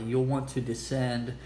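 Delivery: usual speaking voice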